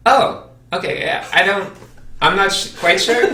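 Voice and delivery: high, hesitant "not quite sure"; lightly voiced puzzled artist; unsure artist. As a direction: fumble politely, mock-unsure. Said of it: "looks up again and says".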